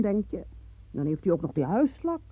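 ordinary delivery

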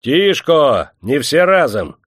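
calling for order. A narrator's reading. Атаман унимает кричащую толпу. (громко призывая к порядку)

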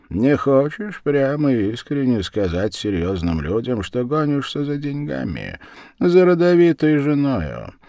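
pointed accusation, pitched high; barbed. A narrator's reading. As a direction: needle them with a high, challenging question. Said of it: "внезапно колко процедил Ворона".

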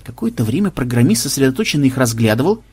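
plain reading voice

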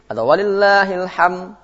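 "walillahil ham" sung high and slow, the final d dropped; wrong ending. Shown as a demonstration. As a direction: chant "walillahil ham" without the closing d. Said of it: "Nggak boleh".

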